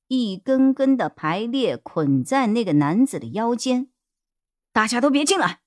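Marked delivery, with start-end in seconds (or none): 4.7-5.6 urgent yell. Acted. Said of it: "叶一辉急忙大吼".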